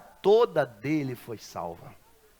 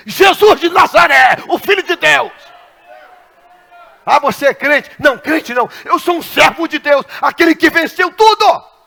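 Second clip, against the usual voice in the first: high, loud declaration; bold proclamation; 10 semitones higher, 15 dB louder than usual. Retold or imitated. Pastor declaring Jesus to the driver, loud and bold. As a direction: proclaim it triumphantly.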